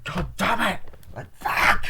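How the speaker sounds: high outburst ending in a loud curse; exasperated cursing. Retold or imitated. Retelling characters stuck in the storm, exasperated cursing. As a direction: curse in acted frustration, exploding on the last word. Acted swearing in retold scene.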